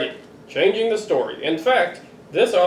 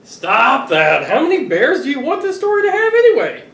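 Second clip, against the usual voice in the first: mock exasperation; 6 semitones higher, 4 dB louder than usual. Exasperated author scolds kids.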